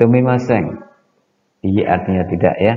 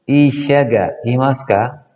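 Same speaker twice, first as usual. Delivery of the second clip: Isha ga imasu ka, slow and a little higher, rising at ka; slow model sentence; 5 semitones higher than usual. Shown as a demonstration. Teacher modelling a question for students: slow, clear drill. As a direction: drill it slowly for the class. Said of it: "kalimat tanyanya".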